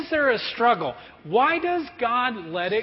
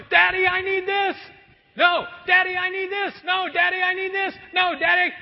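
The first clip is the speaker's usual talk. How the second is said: put-on begging child; 11 semitones higher than usual. Preschooler begging dad for a toy, pleading. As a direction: whine over and over like a kid in the aisle. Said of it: "they're going".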